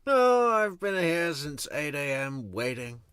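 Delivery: high, loud mocking complaint; complaining voice. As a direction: mimic a whiny witness. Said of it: "She's complaining about waiting".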